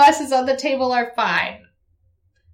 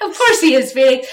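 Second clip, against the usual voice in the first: ordinary talk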